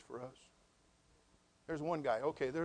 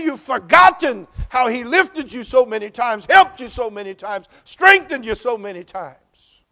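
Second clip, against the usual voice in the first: loud, high preaching with rising fervour; full-force preaching; 7 semitones higher, 17 dB louder than usual. Acted. Pastor challenging the congregation, loud fervent preaching. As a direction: hammer each question home to the pews.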